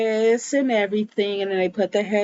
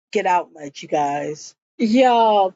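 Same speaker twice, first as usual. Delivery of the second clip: ordinary talk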